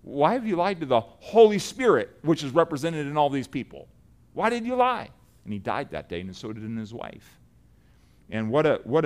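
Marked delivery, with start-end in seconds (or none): 0.0-5.1 rising, louder indignant questioning, climbing high on the last one; Peter, before the church, calls out Ananias with mounting anger; Peter's stern rebuke. Retold or imitated. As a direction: demand it like an outraged apostle confronting a liar. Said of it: "and Peter's like".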